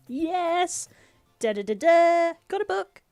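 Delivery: high, loud mock triumph; enthusiastic. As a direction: celebrate with a sung little fanfare. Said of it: "I enthusiastically take the book".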